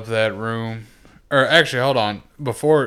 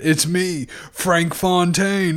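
raised, drawn-out villain announcement; hammed-up higher-pitched villain; gloating villain reveal; 7 semitones higher, much slower than usual. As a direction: announce yourself as the unmasked villain, stretching the name. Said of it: "got a whole different accent".

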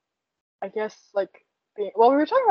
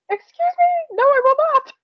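much higher, louder indignant squeal; mock outrage; 6 dB louder than usual. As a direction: squeal it, scandalized. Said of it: "And I said".

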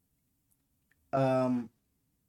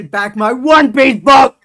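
full-lung yell, much louder; 10 semitones higher, 19 dB louder, slower than usual. Real, not acted. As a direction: yell it from your lungs. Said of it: "good old-fashioned yelling".

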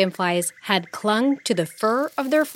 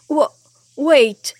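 stammered; 7 semitones higher, 6 dB louder than usual. Acted. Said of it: "he stammered".

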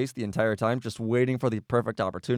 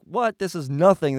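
high, louder mock outburst; acted disbelief; 6 semitones higher, 6 dB louder than usual. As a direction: play a friend's incredulous reaction. Acted friend reacts to blank photo.

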